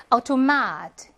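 Automat with a loud, long final MAT; Automat, weight held back for the final MAT; stress on third syllable. Shown as a demonstration. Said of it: "삼 음절의 강세 있어요".

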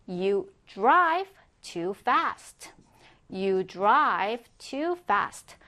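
very slow, separated English words; slow model reading. Shown as a demonstration. Teacher reads a driving example to learners, slow model reading. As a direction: model each word slowly for learners.

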